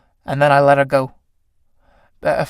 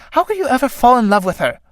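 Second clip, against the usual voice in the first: jealous flirt; 9 semitones higher, faster than usual. Acted. Clytie jealous of Iris.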